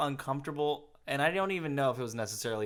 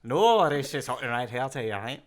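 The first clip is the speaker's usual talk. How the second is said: put-on whispered Irish accent; 6 dB louder than usual. Keeps the Irish impression, whispered.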